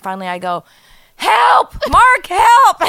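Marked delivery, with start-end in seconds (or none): 1.2-2.8 high, full-volume shout; loud mock-desperate scream; screaming for help. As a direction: re-enact yelling a sleeping husband awake. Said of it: "waking me up screaming help".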